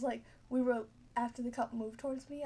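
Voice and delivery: ordinary delivery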